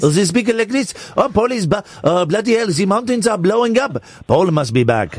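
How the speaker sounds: mock-alarmed Swiss accent. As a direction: fret in a silly Swiss accent. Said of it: "It's a Swiss accent".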